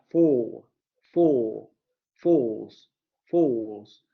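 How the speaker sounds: four said fo with no r; British four. Shown as a demonstration. Teacher showing British four without the r. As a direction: say four and fours with no r at all. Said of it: "No aparece el rotacismo".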